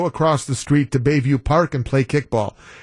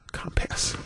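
whispered Compass; 11 dB quieter than usual. Shown as a demonstration. Host whispers "Compass" to correct his stress. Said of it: "Emphasis on the right syllable".

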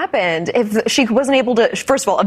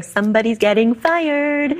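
a hint of a diva, slow; 3 semitones higher than usual. Picturing the singer reacting to the wrong house, light diva impression. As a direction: draw it out like a pop diva. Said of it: "[name]'s voice in my mind".

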